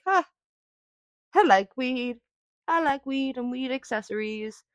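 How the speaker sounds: high, spaced-out stoner voice; dreamy dumb stoner, up high; dopey stoner voice. Retold or imitated. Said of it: "and be like".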